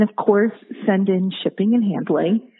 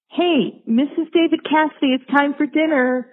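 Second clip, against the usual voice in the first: higher-pitched imitation of mom calling out; mock dinner call, raised pitch at usual volume; 6 semitones higher than usual. Mom calling her daughter to dinner, imitated high call. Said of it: "she would have to call".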